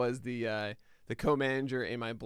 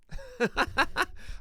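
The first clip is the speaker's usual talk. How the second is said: high-pitched burst of giggling; helpless high chuckling; real laugh. Real, not acted. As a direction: crack up at your own bit. Laughs after saying cheers.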